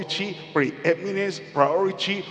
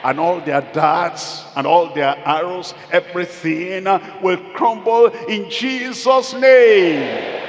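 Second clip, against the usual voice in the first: climactic preaching; 2 semitones higher, 7 dB louder than usual. Real, not acted. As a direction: build to a ringing pulpit climax.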